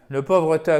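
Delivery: ordinary delivery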